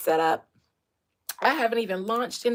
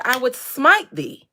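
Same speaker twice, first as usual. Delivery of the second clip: slow threat; 9 dB louder, slower than usual. Acted. Repeated threat.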